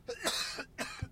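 sudden coughing fit; real cough. Real, not acted. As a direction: hack out a sick cough.

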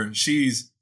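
normal talking voice

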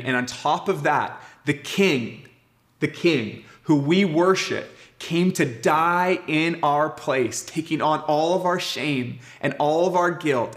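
swelling into full preaching; preaching climax. Acted. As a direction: build it up from the pulpit.

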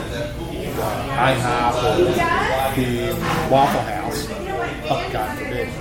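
ordinary talk

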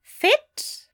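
high-pitched British query; British accent. A narrator's reading. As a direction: echo it as a puzzled British question. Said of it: "the British voice".

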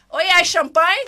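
shouted joking request; calling out. Real, not acted. Host shouting to the crew asking for champagne. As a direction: call out for champagne, laughing.

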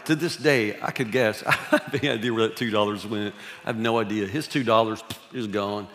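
ordinary talk

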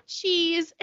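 mock pose for the camera; posing cheese. Injured dad posing for a photo, cheesy.

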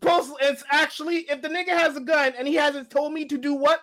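very high-pitched indignant argument; worked-up self-justification; insistent protest. Real, not acted. Protesting in heated banter.

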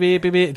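high, loud repeated beeps; imitated car horn. Driver smashing the horn on the highway, imitated as high loud beeps. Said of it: "he is smashing the horn".

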